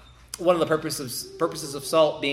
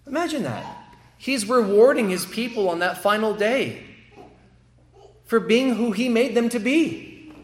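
high, loud, soaring preacher's climax; fervent preaching; 9 semitones higher, 4 dB louder than usual. Acted. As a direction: lift the congregation with awe at grace.

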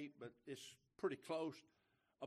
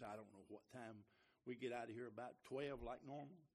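usual speaking voice

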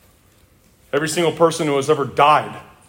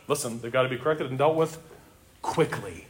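quieter and quicker, pressing the point; hushed urgency; 5 semitones lower, 10 dB quieter than usual. Real, not acted. Preacher urging the church to correct leaders, hushed and urgent. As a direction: lean in and drive it home softly.

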